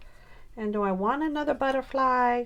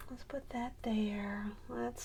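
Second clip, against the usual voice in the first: whisper to herself; 11 dB quieter than usual. Real, not acted. Working quietly.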